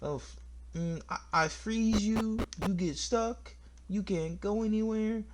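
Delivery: put-on magical boy. Player imitating the party's magical boy casting a spell, high mock voice. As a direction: drag it out in a high, hesitant voice. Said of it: "used a magical spell to go".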